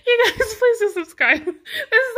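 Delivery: high, loud, breathless laughter; words pushed through giggles; laughing while talking. Real, not acted. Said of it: "I'm out of breath".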